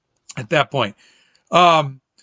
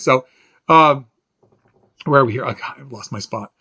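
normal talking voice

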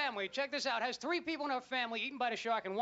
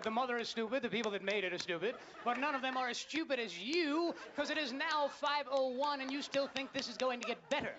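ordinary talk, nothing marked

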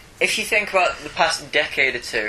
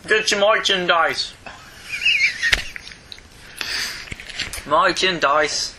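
mock street vendor; 7 semitones higher, slower than usual. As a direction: draw out the sell like a market trader. Hawking merchandise like a vendor.

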